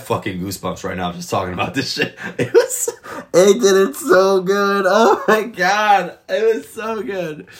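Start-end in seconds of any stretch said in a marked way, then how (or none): 2.3-2.9 breathy high laugh breaking the words; giddy laugh. Real, not acted. Fan gushing about the episode, laughing giddily. 3.3-7.5 much higher, louder and slowed, stretched with awe; gushing. Real, not acted. Fan raving to viewers about the episode, awed and gushing.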